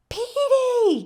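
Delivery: high, loud call across the street. Acted. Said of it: "called the tiny woman".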